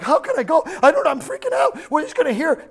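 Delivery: high, hammed-up freak-out; mock panicked cry. Preacher mimics the fearful prophet crying out to God, mock panicked. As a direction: wail it in mock panic, pitch way up. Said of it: "he cries and he's like".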